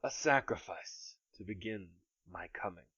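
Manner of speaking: soft, distant sinister threat; menacing smoke creature. Acted.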